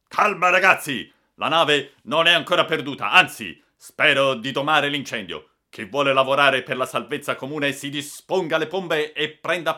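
rally frightened people with a forceful shout; captain shouting. A narrator's reading. (discorso gridato, da capitano che comanda)

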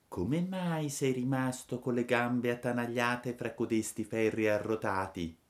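small creature asking gently; firefly questioning the trapped puppet; lightly voiced firefly. A narrator's reading.

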